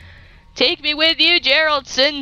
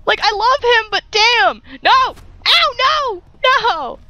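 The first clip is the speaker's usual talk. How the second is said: panicked; 10 semitones higher than usual. Real, not acted. Getting shot in game.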